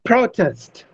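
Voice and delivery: "protest" as a noun, PRO-test; stress on first syllable. Shown as a demonstration. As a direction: hit PRO in "protest", falling away after it. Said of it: "put a stress on the first syllable".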